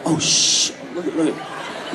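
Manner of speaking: hushed, unvoiced whisper; whispering fans. Fans whispering to each other on spotting him. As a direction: whisper it excitedly to a friend. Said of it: "they're like".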